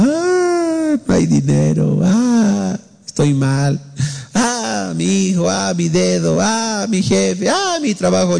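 imitating a whiny lament of woes; hammed-up moaning sigh on each Ah; mock weeping over every problem; mock whimpering. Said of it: "Llorando, llorando".

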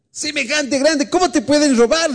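high-pitched mock disbelief; incredulous. Preacher replaying his reaction to big friend's robbery, incredulous. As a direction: question it as if you can't believe it.

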